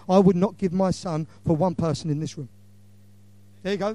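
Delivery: normal talking voice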